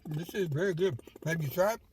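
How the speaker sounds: talking through a mouthful; words round a bite of food; mouth full. Real, not acted. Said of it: "talking with my mouth full".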